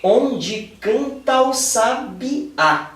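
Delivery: very slow, syllables spaced, tá and o run together; slow scansion reading. Shown as a demonstration. Teacher showing students how to count the verse, drawn out slowly. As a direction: scan "canta o" as one syllable, slowly. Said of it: "Vamos ver como é que vai ficar".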